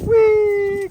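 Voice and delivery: loud, very high whee; playful squeal. Real, not acted. Owner before kissing Bambi, loud high squeal.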